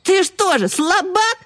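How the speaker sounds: very high, taunting owlet voice; contemptuous. A narrator's reading. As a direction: sneer it as a high-pitched challenge. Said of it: "презрительно защёлкали клювами Чуня и Гек". (презрительно, высоким голосом)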